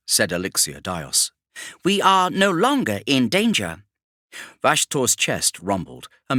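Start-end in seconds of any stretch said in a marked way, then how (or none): 1.8-3.8 higher, odd mechanical voice; a tech-priest chiming in; other Magos. Acted. Elixir Dios backs up Ennis, higher and odd-sounding.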